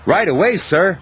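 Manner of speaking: high, bright eager reply. Acted. Thomas answering the quarry manager, eager. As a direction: snap to it eagerly. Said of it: "whistled Thomas".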